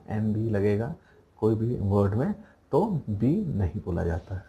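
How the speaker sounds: ordinary delivery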